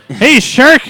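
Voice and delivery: high, loud mock shout. Mocking the sandwich man, loud mock shout. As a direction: yell it at the shark.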